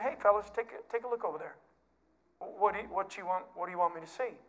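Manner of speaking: hushed, confiding teacher pointing something out; quiet aside. Retold or imitated. Jesus quietly points disciples toward the widow, hushed. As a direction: lean in and murmur to the disciples.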